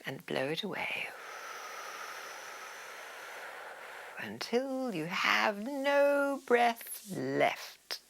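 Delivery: soft, slow meditative guidance with drawn-out words; guided breathing. Real, not acted. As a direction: ease them through the breath, hushed and unhurried. Guides breathing exercise, softer than instructions.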